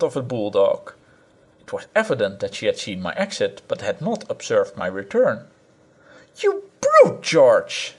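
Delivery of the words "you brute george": much higher, shrill-pitched scream of rebuke. A narrator's reading. Wife to her husband George, a high screaming rebuke. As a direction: scream at him like an enraged chicken. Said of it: "she screamed".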